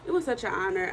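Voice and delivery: normal talking voice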